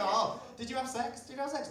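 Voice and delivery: acted inquisitive friend, high voice; mock nosy questioning. Acting out nosy questioner, second time.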